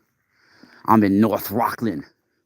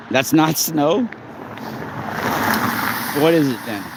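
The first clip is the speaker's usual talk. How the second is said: surprised; 8 semitones higher than usual. Real, not acted. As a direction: sound amazed at the snow. Surprised at the snow.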